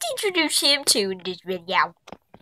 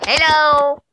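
loud cheery greeting; 6 semitones higher, 8 dB louder than usual. Real, not acted.

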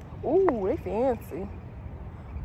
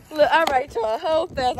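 usual speaking voice

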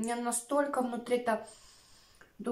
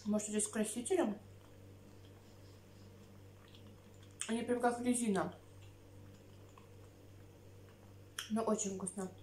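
talking while chewing; chewing sushi roll; mouth full; 6 dB quieter than usual. Real, not acted. Seen 2: Chews with food in mouth throughout, cheeks moving while talking.

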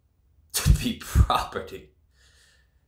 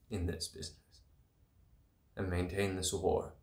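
dropped to near whisper; 3 semitones lower, 12 dB quieter, faster than usual. Acted. Dropping to low intensity.

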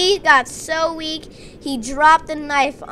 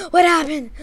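shocked gasp; 3 semitones higher than usual. Acted. Reacting to surprise rescue.

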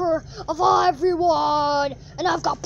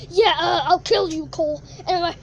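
usual speaking voice

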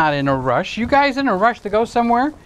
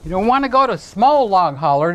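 ordinary delivery

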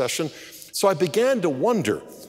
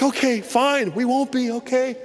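hasty backing down; 9 semitones higher than usual. Retold or imitated. Husband backs down from tearful wife.

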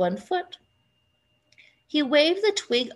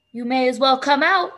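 louder, mildly frowning call; mild grumpy summons, voice raised; 3 semitones higher, 6 dB louder than usual. A narrator's reading. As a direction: say it firmly with a slight frown. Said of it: "he said with a frown".

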